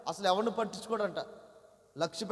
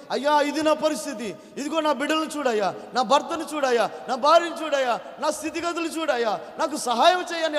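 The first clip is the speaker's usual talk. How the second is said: pleading prayer; 6 semitones higher, 7 dB louder than usual. Acted. Acted prayer plea to God.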